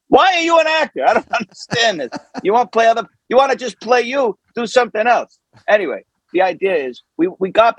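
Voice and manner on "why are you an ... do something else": very high-pitched, exasperated mock outburst. Coach fed up with partner refusing to swear, exasperated. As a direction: throw up a high, baffled protest.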